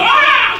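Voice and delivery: mock high, loud scream; hammed-up cry of a rattled demon; disturbed devil scream. Mocking idea that pitch scares the devil, screaming. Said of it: "iblis akan terganggu".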